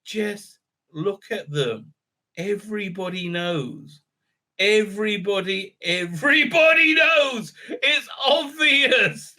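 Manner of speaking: starts slow and hushed, builds to a high shout; dramatic retelling. Retold or imitated. Boy accuses the king's children before court, hushed then shouting. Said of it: "this great scene where he goes".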